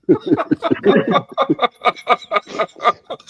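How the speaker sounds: long, high burst of laughter; real belly laugh. Real, not acted. Storyteller cracks up after Grant's "Australia", laughing. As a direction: crack up helplessly at your own story.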